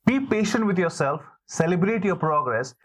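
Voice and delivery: ordinary talk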